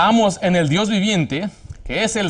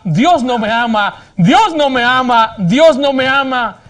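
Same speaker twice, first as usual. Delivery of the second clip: rising, slow shout of despair, each time louder; retold crying shout; 6 semitones higher, 6 dB louder, slower than usual. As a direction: cry it out, slower and higher each time. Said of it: "ella se fue y gritaba". (grito desesperado que sube y se alarga)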